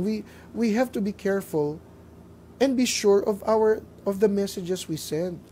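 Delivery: ordinary talk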